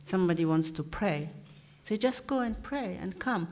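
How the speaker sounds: normal talking voice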